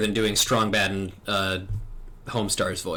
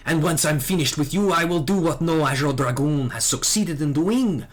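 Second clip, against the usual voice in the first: hint of a French accent, proud and accusing; a young knight's cold contempt in a French accent; 7 semitones higher than usual. Dragoon confronting his old master, bitter French accent. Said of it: "time to rev up the French accent again".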